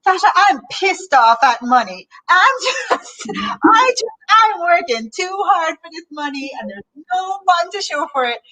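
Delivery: imitated thick Jamaican accent, high and exasperated; [name]'s Jamaican accent. Retelling a friend's complaint about money, angry Jamaican accent. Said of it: "in her Jamaican accent".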